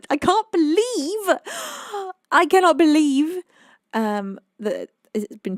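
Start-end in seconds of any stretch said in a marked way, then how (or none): 0.1-3.4 loud, high-pitched astonishment; amazed disbelief. Real, not acted. Streamer amazed the stream has run two hours, incredulous.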